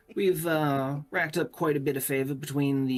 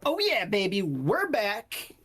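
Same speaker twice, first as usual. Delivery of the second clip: high, whooping character voice; rowdy cheer announcing a big arrival; loud, boisterous; 9 semitones higher than usual. Acted. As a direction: burst in with a triumphant holler. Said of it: "a rather loud and boisterous voice go".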